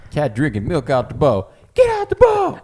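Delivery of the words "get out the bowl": high, loud mock scolding; comic quoted shout. Quoting cat drinking milk bit, high shout. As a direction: yell it as a catchphrase.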